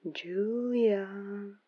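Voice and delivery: lilting, teasing man's voice; sing-song voice. Acted. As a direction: call her name in a sing-song. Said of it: "said the sing-song voice of a man".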